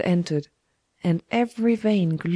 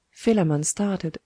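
ordinary delivery, nothing marked

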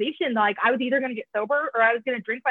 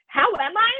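retold furious retort; 4 semitones higher than usual. She snaps back at the greeter outside the meeting. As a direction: throw it back at him, indignant. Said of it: "I'm pissed. Yeah, I'm furious".

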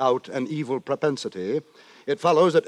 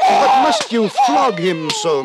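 shocked outcry; 7 semitones higher, 8 dB louder, slower than usual. Acted. Narrator protests mother's flogging.

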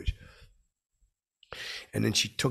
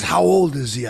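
higher, loud demand; loud challenge; 6 semitones higher, 12 dB louder than usual. Retold or imitated. Retelling the player challenging his story about the nephew, loud and disbelieving. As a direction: imitate an incredulous challenge, pitched up.

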